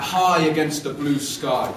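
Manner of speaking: plain reading voice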